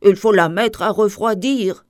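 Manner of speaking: mild narrated cry. A narrator's reading. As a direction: call it out firmly, storybook style. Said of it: "crie le vieux".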